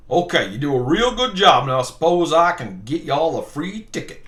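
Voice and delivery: hammy Southern boss, Foghorn Leghorn style; cartoon Southern accent. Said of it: "I'm allowed to do that accent".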